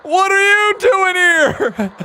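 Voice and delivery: high, slow imitation of the wrestler; mock repeat of the shouted question; mocking echo. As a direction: parrot it back high and drawn out. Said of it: "echoes the wrestler's line".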